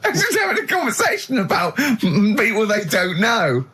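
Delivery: amused, laughing through it; half-laughing protest. Real, not acted. Mocking the pointless chat, laughing.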